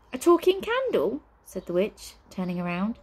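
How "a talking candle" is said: delighted. Acted. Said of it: "her eyes gleaming in delight".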